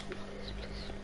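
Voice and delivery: barely audible, whispered begging; whispered plea. Real, not acted. Player hoping for a catch while fishing, whispered plea. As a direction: plead under your breath. Pleading under breath for a catch.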